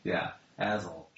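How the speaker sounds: usual speaking voice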